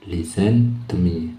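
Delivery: slow, careful listen to me; slow teacher demo; slow model phrase. Shown as a demonstration. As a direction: draw it out for learners to copy. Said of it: "dibunyikan listen aja".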